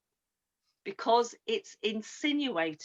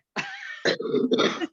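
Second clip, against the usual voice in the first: high burst of laughter; 4 dB louder than usual. Real, not acted. Laughing at own forgetfulness.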